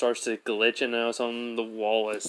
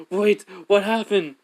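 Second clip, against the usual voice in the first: higher, louder, slow shocked question; louder surprise at the vanished hole; startled, louder and higher; 8 semitones higher, 6 dB louder, slower than usual. Retold or imitated. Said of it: "He goes".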